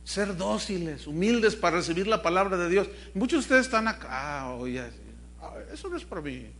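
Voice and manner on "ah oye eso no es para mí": low, soft mock aside; dismissive listener. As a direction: mumble it like someone excusing himself. Imita al oyente que se excusa. (oyente desentendido, en voz baja, imitado)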